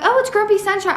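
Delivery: gushing in someone else's voice; mock excited fan. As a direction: imitate a thrilled reader, pitch jumping up. Voicing viewers excited about the trope.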